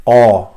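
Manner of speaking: qa hit loud and full from the throat; full sound. Shown as a demonstration. Teacher stressing it's a full sound, loud throaty qa. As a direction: punch out a hard qa far back. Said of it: "it's a full sound".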